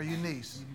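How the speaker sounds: "Eunice" ending in a long ee, like "niece"; name pronunciation check. Shown as a demonstration. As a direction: say "Eunice" as YOO-NEES. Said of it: "is that how it's pronounced".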